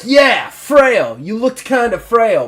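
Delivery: loud, high burst of recognition; booming guard finding his word; emphatic agreement. Acted.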